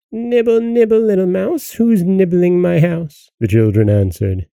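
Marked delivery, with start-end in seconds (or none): 0.1-3.3 high, thin sing-song witch voice; shrill call. A narrator's reading. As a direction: call out shrilly, drawing out the end. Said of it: "a shrill voice called out".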